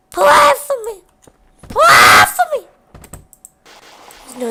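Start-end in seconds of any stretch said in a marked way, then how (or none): 0.1-1.0 very high squeaky mock plea; dying plea. Chica dying and begging for prayers, very high squeak. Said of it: "She would just die like". 1.7-2.7 loud mock wail; dying plea shouted. Chica dying, loudly wailing for prayers. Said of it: "She would just die like".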